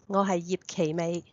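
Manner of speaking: ordinary talk, nothing marked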